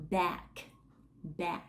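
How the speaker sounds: "back" with a tense a and an unvoiced k ending; "back" as /bæk/, sharp final k; back demo. Shown as a demonstration. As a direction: say "back" twice, k crisp. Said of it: "các bạn sẽ đọc cùng các âm như sau".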